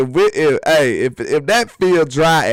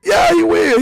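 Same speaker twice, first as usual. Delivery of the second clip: high, loud emphatic yeah; answering his own question with emphatic certainty; emphatic yes; 13 semitones higher, 4 dB louder than usual. Real, not acted.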